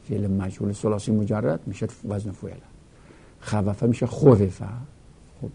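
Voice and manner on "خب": ordinary talk, nothing marked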